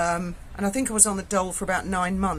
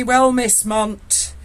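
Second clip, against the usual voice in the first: louder, slow and teasing; teasing sergeant voice, louder; jokey sergeant; 5 semitones higher, 6 dB louder, slower than usual. Retold or imitated. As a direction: play the knowing custody sergeant greeting a regular. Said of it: "And he said to me".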